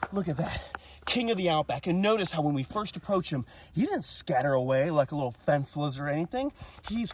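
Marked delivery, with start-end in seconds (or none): none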